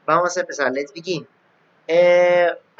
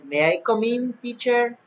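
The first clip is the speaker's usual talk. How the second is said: higher-pitched pupil's polite request; imitated student asking; 8 semitones higher, slower than usual. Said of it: "Cuando ustedes me digan".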